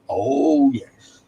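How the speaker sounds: a drawn-out 'oh, yes' that fades to a breath, like the insurance-ad bulldog; lightly nod it out like the nodding dog; Churchill bulldog impression. Joking with Dave on stream, a lightly voiced bulldog 'oh yes'. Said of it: "Why did I do an impression of a bulldog?".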